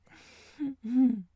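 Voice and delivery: short wicked giggle; evil giggle. Acted. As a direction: giggle with sinister delight. Villain gloating over captive.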